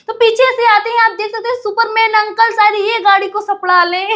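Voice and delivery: playful high-pitched announcement; amused excitement. Real, not acted. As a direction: excitedly poke fun, voice lifted, about to laugh. Joking about the running Superman uncle.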